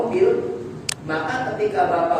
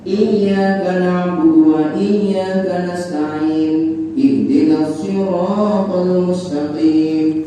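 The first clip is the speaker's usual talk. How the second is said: slow, chanted, louder recitation; Fatihah recitation; 6 dB louder, much slower than usual. Shown as a demonstration. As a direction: sing the verses in a steady tajwid melody. Teacher recites rest of Fatihah.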